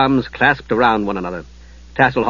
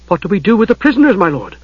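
fast, higher urgent demand; 7 semitones higher, faster than usual. Acted. Knight demanding orders about the prisoners. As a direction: press the lord for orders. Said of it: "someone demanded".

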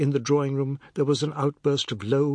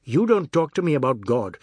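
louder, heated scolding; indignant raised voice; angry rebuke; 4 semitones higher, 5 dB louder than usual. A narrator's reading. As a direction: snap at him to stop. Angry rebuke in family quarrel.

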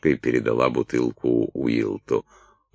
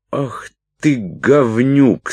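high, loud, slow exclamation of outrage; furious curse; 11 semitones higher, 5 dB louder, much slower than usual. A narrator's reading. Салли shoves Уилт with all her strength, furious. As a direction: spit out the insult, drawing it out. Салли бранится и толкает Уилта.

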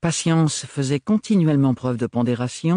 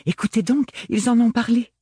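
put-on much higher, eager girlish call; girl calling her sisters to the gazette, excited and high; excited exclamation; 10 semitones higher than usual. A narrator's reading. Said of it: "s'exclama Gaieté".

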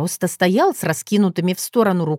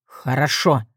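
low, resigned breath out; exhaled concession; 5 semitones lower than usual. A narrator's reading. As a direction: give in on an out-breath. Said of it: "выдохнул он". (на выдохе, низко)

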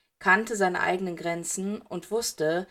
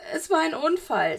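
much higher, pleading voice; distressed boy; 9 semitones higher, 3 dB louder than usual. A narrator's reading. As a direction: plead desperately through tears. Sobbing boy pleads innocence.

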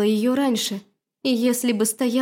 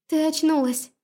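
joyful surprise; 6 semitones higher than usual. A narrator's reading. Joyful greeting.

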